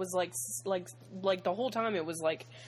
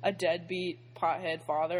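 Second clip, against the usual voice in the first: ordinary talk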